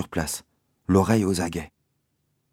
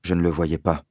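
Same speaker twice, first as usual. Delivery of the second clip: plain reading voice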